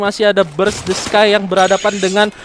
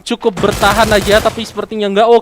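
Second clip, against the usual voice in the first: normal talking voice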